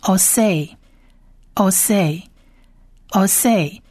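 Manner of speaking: "osei" said slowly and carefully three times; teacher repeating "osei" slowly for students; slow Hebrew drill. Shown as a demonstration. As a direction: model "osei" syllable by syllable. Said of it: "就念成".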